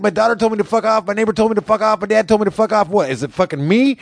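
high, strained imitated rant; brother impression. Brother listing who cursed at him, high imitated rant. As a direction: rattle off grievances in a high mimicking voice. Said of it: "he talk like this".

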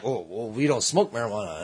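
low, mock-sober denial; imitated prim bystanders. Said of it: "And they're all like".

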